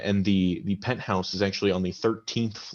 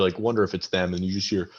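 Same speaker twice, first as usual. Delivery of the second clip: ordinary delivery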